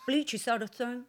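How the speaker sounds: lightly voiced Donald Trump, pouting mouth; a hint of Trump, lips pushed forward; mild Trump take; Trump impression. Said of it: "how's your Trump".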